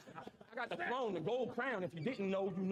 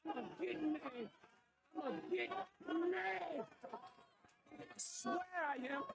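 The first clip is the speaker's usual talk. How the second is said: mock whiny threat; 8 semitones higher, 4 dB quieter than usual. Playful whiny threat mid-rehearsal.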